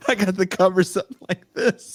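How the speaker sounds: high, laughing through the words; laughing while hiding under covers. Real, not acted. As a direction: giggle as you tell it.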